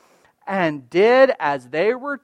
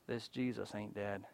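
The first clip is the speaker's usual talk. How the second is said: much softer, low, almost breathed; hushed intensity; 10 semitones lower, 24 dB quieter than usual. Acted. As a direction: lean in and confide it. Preacher drops to hushed intensity.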